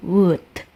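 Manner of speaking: Would said as wud, L silent; would without the L. Shown as a demonstration. Teacher repeating would for students, silent L. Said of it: "nggak usah diucapin L ya".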